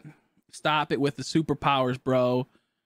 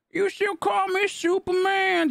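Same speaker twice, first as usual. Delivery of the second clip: mock quote; 13 semitones higher, slower than usual. Mock sung quote in play.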